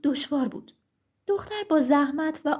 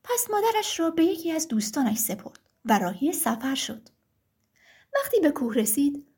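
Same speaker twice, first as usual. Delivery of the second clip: ordinary delivery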